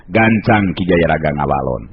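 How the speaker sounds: usual speaking voice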